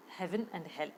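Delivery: ordinary delivery, nothing marked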